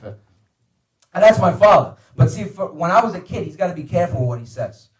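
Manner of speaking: ordinary talk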